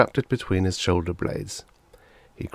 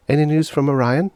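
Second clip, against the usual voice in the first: put-on boy's voice; 9 semitones higher, 5 dB louder than usual. Boy asks parents.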